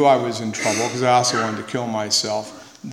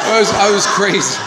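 laughing; 7 semitones higher, 7 dB louder than usual. Real, not acted. Telling the meeting, laughing at himself. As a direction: laugh through it, high and loud. Laughing self-mockery at recovery talk.

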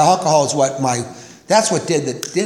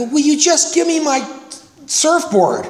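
exasperated; 10 semitones higher than usual. Retold or imitated. Arguing with surf shop guy.

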